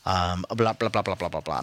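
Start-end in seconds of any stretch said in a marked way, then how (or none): none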